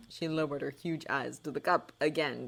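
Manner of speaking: plain reading voice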